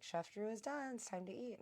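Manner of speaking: high sing-song call to the table; lightly voiced friend at the door; calling out dinner. [name] popping out to announce dinner, high bright call.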